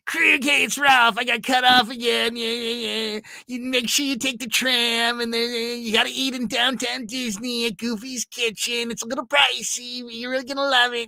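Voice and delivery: high, eager rambling voicemail; Ralph's voicemail. Retold or imitated. Voicemail from coworker about Disneyland tips, high and eager. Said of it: "All back and forth".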